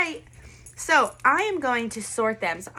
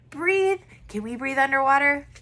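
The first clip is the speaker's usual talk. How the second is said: ordinary delivery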